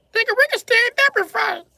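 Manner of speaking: furious shout. Real, not acted. Pinned partner shouting a challenge to fight. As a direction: scream the challenge.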